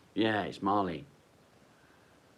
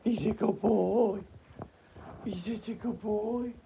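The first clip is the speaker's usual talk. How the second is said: high dog praise. Real, not acted. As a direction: praise the dog in a squeaky pet voice.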